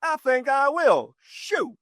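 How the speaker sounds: high, slow, offhand decision; casual resolve. Retold or imitated. Author to park ranger, high and slowly offhand. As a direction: shrug it off like it's easy. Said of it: "I said".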